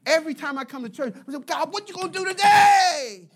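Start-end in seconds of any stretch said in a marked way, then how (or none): 1.4-3.3 high, loud call to God; acted whoop of anticipation; eager shout. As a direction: holler it with excited expectation. Acting excited churchgoer calling to God.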